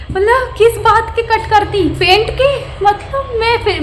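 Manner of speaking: high, loud disbelieving outburst; incredulous. Real, not acted. Vlogger fumes at landlady's demand, high incredulous outburst. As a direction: ask it in shocked disbelief. Incredulous rant about paint money.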